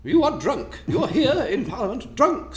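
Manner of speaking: hammed-up shrill-free high accusing tone; indignant lady. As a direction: play a scandalised lady accusing him. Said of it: "from some lady".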